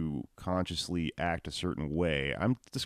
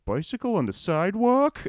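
mock dumb man; 13 semitones higher, 3 dB louder than usual. Mocking the rule-following man.

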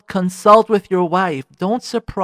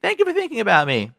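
higher, gentle, sweet thanks; said nicely; 6 semitones higher, faster than usual. Shown as a demonstration. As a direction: thank him sweetly and appreciatively. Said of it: "Tell him nicely".

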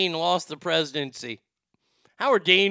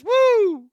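mock whoop; 11 dB louder than usual. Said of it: "Indian Stackar says".

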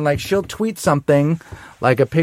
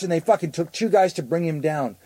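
normal talking voice

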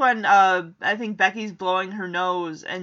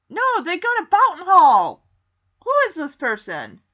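loud, high-pitched indignation; hammed-up protest at a plot hole; mock outrage; 8 semitones higher, 9 dB louder than usual. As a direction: object loudly, as if scandalized.

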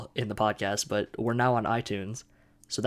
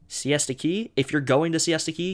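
mocking, higher and louder; mocking emphasis; 6 semitones higher, 4 dB louder than usual. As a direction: repeat it like it's obvious. Mocking the trailer line again.

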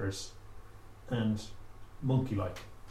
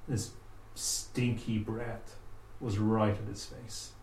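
ordinary delivery

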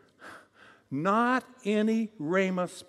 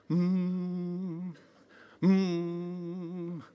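mock guru humming; 5 dB quieter than usual. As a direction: hum like a smug guru. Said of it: "humming with a harmonic convergence".

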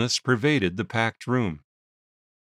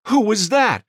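startled question; 11 semitones higher, 6 dB louder than usual. A narrator's reading. Judge interrupting surprised.

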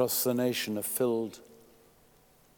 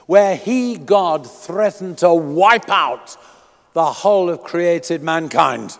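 loud, raised pulpit reading; fervent sermon reading; preaching at full force; 4 semitones higher, 11 dB louder than usual. Acted. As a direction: proclaim the scripture to the congregation. Preaching and reading scripture aloud.

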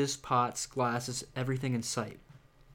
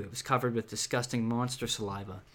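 ordinary delivery

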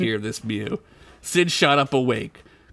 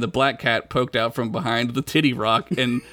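usual speaking voice

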